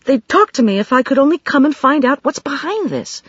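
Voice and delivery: put-on high, sobered worried young voice; steady yourself, still shaken. A narrator's reading. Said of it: "In a sobered voice".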